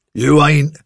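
a father refusing his daughter; flat refusal. Acted. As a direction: shut her down.

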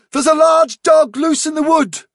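very high, ringing cry of alarm; insistent warning. A narrator's reading. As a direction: drive the warning home at full urgency. Warning repeated with more force.

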